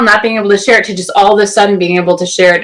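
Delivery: usual speaking voice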